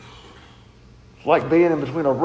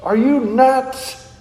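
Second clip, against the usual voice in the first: higher, incredulous "what, you're crazy?"; slowed, pitched-up disbelief; mock incredulous friend; 6 semitones higher, slower than usual. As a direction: play the friend who thinks it's madness. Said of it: "he looks over at Jonathan and he says".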